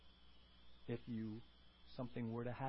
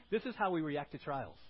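usual speaking voice

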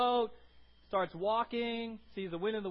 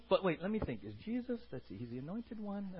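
mock academic musing; 3 semitones lower, 5 dB quieter than usual. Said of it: "he didn't get all academic".

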